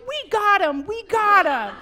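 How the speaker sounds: loud high triumphant cheer; gleeful shout. Retold or imitated. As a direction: crow it out in triumph. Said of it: "they're like".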